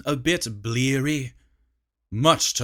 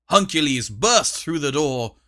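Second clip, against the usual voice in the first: plain reading voice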